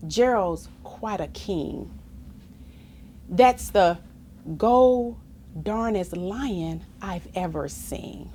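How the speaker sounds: plain reading voice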